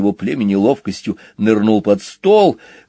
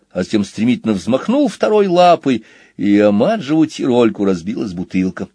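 plain reading voice

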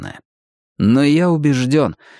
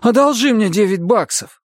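high-pitched begging; pleading; 10 semitones higher than usual. A narrator's reading. Arnie asking his friend for a loan, pleading. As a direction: beg a friend urgently, pitched well up. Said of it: "взмолился Арни".

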